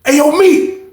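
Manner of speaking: high loud call; calling through the wall. Retold or imitated. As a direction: call to the next cell. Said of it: "He was like".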